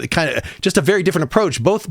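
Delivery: ordinary delivery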